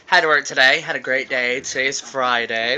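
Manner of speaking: ordinary talk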